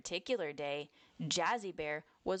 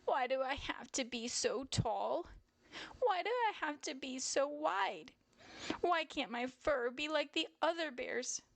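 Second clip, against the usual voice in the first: higher-pitched, plaintive little bear; understated tearful complaint, pitched up; mild crying lament; 8 semitones higher than usual. A narrator's reading. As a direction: lament it in a high child-bear voice. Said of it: "Jazzy Bear would cry and cry".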